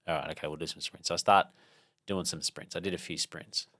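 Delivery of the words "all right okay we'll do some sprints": quick, low, soft grudging consent; reluctant agreement. Retold or imitated. Runner yielding to an inner boss voice, reluctant. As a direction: give in fast and under your breath.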